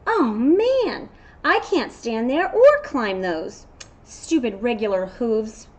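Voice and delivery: a jealous unicorn moaning; envious unicorn.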